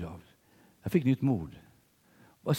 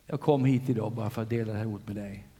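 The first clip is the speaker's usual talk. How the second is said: altar call. Acted. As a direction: invite the congregation forward, gently then joyfully. Altar call over music. (förbön med musik, mjukt och sedan starkare)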